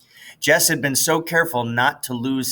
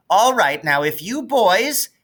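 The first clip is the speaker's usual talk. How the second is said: teacher; 9 semitones higher, 4 dB louder than usual. Acted. Teacher releasing the boys.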